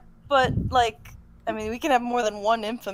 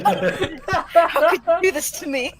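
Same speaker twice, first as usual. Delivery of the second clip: mock dramatic wail; 7 semitones higher, 8 dB louder, slower than usual. Mock melodrama joke, laughing.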